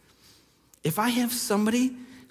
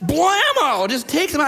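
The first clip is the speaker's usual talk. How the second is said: excited blammo; 7 semitones higher than usual. Retold or imitated. Preacher telling how the niece hit her brother, excitedly. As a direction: land the hit like a wrestling call.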